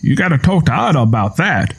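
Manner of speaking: a hint of the old farmer's voice; lightly voiced old farmer. A narrator's reading. Tom deflecting Lenny's question, lightly voiced old farmer. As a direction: brush her off like an old farmer. Tom's voice.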